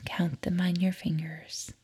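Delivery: soft whispered instruction; whispering. Acted. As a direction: whisper the step gently. Instruction during counting exercise.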